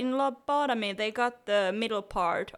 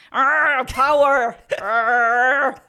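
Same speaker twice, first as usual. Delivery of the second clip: mock angry growl; 8 dB louder, much slower than usual. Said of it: "You get the anger".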